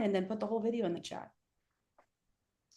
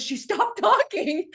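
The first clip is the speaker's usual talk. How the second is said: laughing quote; 11 semitones higher, 7 dB louder, slower than usual. Retold or imitated. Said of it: "He goes".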